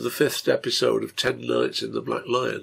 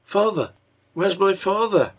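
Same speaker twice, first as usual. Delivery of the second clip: put-on anxious child; 8 semitones higher, 4 dB louder than usual. A narrator's reading. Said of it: "looking anxiously from face to face".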